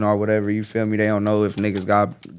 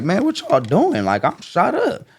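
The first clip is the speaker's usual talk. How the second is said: exasperated plea; 8 semitones higher, slower than usual. Retold or imitated. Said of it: "I'm like".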